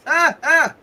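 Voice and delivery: two high, loud acted "ah"s; warding-off yelps. Game master acts Spidey holding up a cross, high warding yelps. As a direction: yelp sharply as if fending off a vampire.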